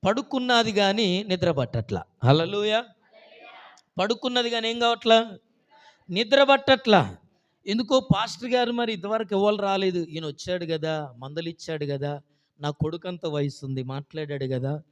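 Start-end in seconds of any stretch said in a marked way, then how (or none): 0.1-9.5 loud, high, rising sermon fervour; preaching at full force. Acted. Preacher telling the church about the widow, in fervent full voice. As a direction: drive the story home to the congregation. Preacher builds the story loudly.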